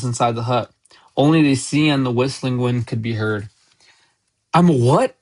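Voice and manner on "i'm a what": gasped, high astonished question; Harry. A narrator's reading. Boy learning he is a wizard, astonished gasp. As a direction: blurt it louder, in disbelief. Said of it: "gasped Harry".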